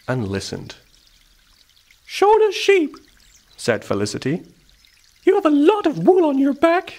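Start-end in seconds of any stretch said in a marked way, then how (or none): 2.1-2.9 very high, loud squeaky call; field mouse voice. A narrator's reading. Field mouse turns to the sheep, squeaky. 5.2-6.9 very high, squeaky remark; field mouse voice. A narrator's reading. Field mouse leads up to her idea, squeaky.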